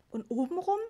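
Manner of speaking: whining. A narrator's reading. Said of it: "jammerte Mama".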